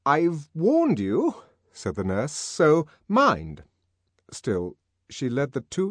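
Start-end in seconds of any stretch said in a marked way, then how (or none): 0.0-3.6 higher, louder, slow shaky authority; trying to sound in charge; shrill uncertain dignity. Acted. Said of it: "a dignity that was a trifle shrill and uncertain".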